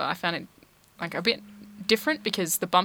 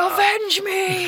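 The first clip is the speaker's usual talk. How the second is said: high, slow, loud dying cry; overacted death scene; 14 semitones higher, 6 dB louder, much slower than usual. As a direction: ham up a dying guard's last words. Mock dying guard cry.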